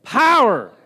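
rousing call-and-response shout; loud call of the word. Shown as a demonstration. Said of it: "Let's say it".

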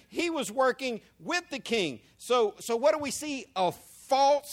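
usual speaking voice

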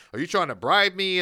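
loud stern cop. Retold or imitated. Retold cop's stern question.

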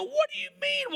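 retold high-pitched incredulity; indignant retort. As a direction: voice leaping high in mock disbelief. Retold indignant reply to friend.

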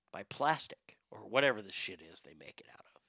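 hushed throwaway aside; whispered aside. Real, not acted. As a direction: drop to a whisper. Trailing aside about dentures.

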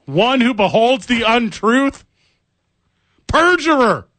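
high, slow, theatrical denouncing; mock dramatic accusation. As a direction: denounce him like a stage villain. Mock accusing co-host of lying.

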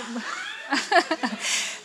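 high, quiet imitated whimper; soft hammed-up sob noises; mock sobbing. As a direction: fake crying left alone, trailing on. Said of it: "I'm, like, in the car, like".

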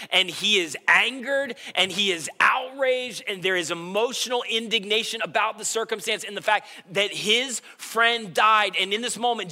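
preaching at full force. Acted. Pastor to congregation, preaching with mounting indignation. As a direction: preach it with rising outrage.

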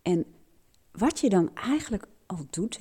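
ordinary delivery

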